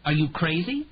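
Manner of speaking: shocked, high and loud disbelief; a gasped, incredulous question; gasping in disbelief. A narrator's reading. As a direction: she can't believe what she's hearing. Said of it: "Sachs almost gasped".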